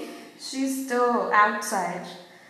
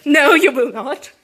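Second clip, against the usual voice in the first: loud insistent no, half laughing; loud refusal; 3 semitones higher, 10 dB louder than usual. Acted. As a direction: repeat it louder, losing patience. Repeating refusal to sister.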